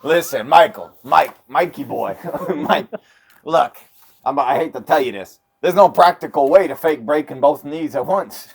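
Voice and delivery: smarmy Hollywood sleaze talking down to an actor; Hollywood sleazeball. Retold or imitated. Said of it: "It's my impression of a Hollywood, uh, sleazeball".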